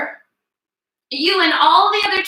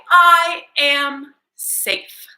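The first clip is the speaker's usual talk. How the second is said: slow affirmation; 4 dB louder, much slower than usual. Shown as a demonstration.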